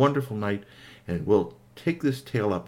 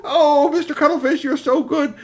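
grateful character; 7 dB louder than usual. Acted. Grateful character thanks the repairer.

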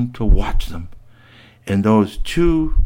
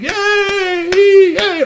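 put-on kids cheering; 7 dB louder than usual.

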